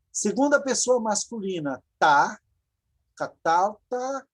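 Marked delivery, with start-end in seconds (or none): 3.2-4.3 1 slow model word. Shown as a demonstration.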